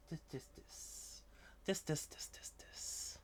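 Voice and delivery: soft whispered cooing; hushed pet whisper. Real, not acted. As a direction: breathe it to the kitten. Whispering to cat.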